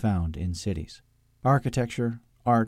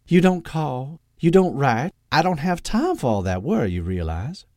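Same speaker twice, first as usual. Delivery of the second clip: high, loud nagging reproach; nagging Southern mother; 5 semitones higher, 4 dB louder than usual. A narrator's reading. Mother guilt-tripping her son, nagging.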